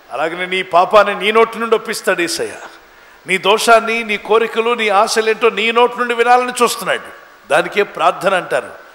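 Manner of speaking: loud, driving sermon delivery; forceful preaching. Real, not acted. Preacher telling congregation what the world seeks, forceful and loud. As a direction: preach it out hard, rising on key lines.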